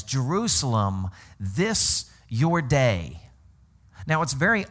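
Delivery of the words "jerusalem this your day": Jerusalem, this your day drawn out; weight on this, slowly; solemn, deliberate Jesus quote; slow, weighty quote. Retold or imitated. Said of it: "this is what he literally says".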